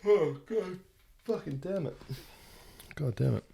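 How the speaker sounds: words stretched through a real yawn. Real, not acted. Podcaster apologising mid-yawn, words through the yawn. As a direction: talk through a big yawn. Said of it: "I need to yawn".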